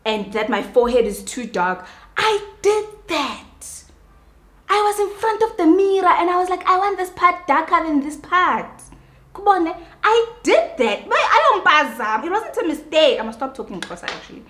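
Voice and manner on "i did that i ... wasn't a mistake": much higher, heated and emphatic; high-pitched indignant rant; exasperated insistence. Real, not acted. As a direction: insist hard, almost shouting. Insisting she did it deliberately.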